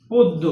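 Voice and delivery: পদ্ম said "poddo", first vowel as o; পদ্ম with a rounded o and doubled d; ওকার in পদ্ম. Shown as a demonstration. Teacher to students modelling the word, পদ্ম as "poddo". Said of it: "জফলা থাকার কারণে ওকার হবে".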